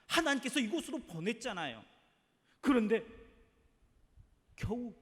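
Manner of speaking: high, loud, accusing cry to God; angry complaint. Acted. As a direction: hurl the grievance upward in outrage. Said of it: "원망의 말을 쏟아냈습니다".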